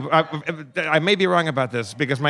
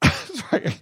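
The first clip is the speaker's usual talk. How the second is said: normal talking voice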